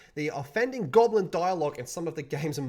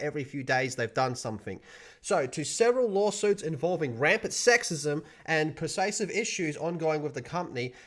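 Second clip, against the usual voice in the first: ordinary talk, nothing marked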